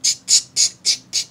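breathy monkey ch-ch sounds; monkey chatter. Acted. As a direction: chatter back like cheeky monkeys. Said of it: "the monkeys ... said".

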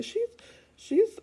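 ordinary delivery

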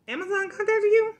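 can't believe what she just heard; incredulous disbelief. Retold or imitated. Coworker says Amazon called her, high incredulous disbelief. Incredulous reaction to scam claim.

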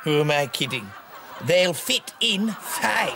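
ordinary talk

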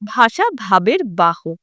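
slow, spelling out each word for notes; slow emphatic dictation. Shown as a demonstration. Teacher marks a key exam line, slow and deliberate. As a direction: dictate it slowly so students can write it down. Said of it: "এটাও পরীক্ষার জন্য মনে রাখতে হবে".

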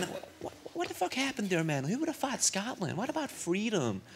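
put-on high-pitched, incredulous American accent; American guy. American challenges a Scot about freedom, high mock-American voice. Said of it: "an American guy".

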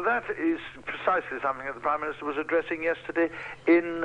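ordinary talk

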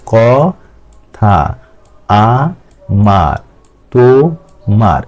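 very slow ক-থা, আ-মার, তো-মার, gap between syllables. Shown as a demonstration. Teacher demonstrating disyllables to students, ক-থা, আ-মার, তো-মার broken slowly in two. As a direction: split ক-থা, আ-মার, তো-মার into two drawn-out beats each. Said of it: "দুই বের আলাদা আলাদা শব্দ উচ্চারণ করছি".

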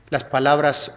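ordinary talk, nothing marked

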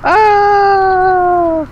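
high, hammed-up fake fright; mock a faker screaming in fear; fake scared gamer. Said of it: "when people are like, "Oh my god, it's so scary!".

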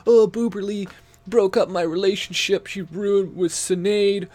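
high, loud whiny mock complaint; mocking Andy. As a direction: whine it like a sulking crybaby. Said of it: "Now he's like".

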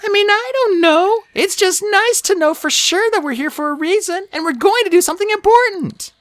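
high young dragon voice, earnest but downplaying it; modestly hopeful high-pitched character voice; Sunny, hopeful dragonet. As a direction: speak high, trying not to sound too dramatic.

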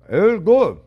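talking through a mouthful of food; mouth full. Real, not acted. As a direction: say it while still chewing. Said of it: "Don't talk with your mouth full".

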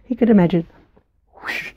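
ordinary delivery, nothing marked

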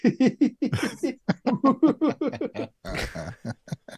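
very high-pitched crush giggling; long squealing titter, acting out a crush; nervous giddy giggle. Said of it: "like, really nervous and giddy".

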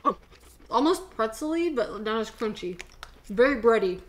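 speaking around food; chewing while talking. Real, not acted. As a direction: hand covering mouth. Seen 0: Woman holds food at lips and chews through labelled words.

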